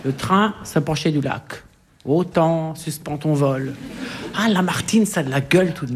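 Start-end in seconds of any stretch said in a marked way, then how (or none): 2.0-3.8 poem recital. Retold or imitated. Quoting Lamartine's poem.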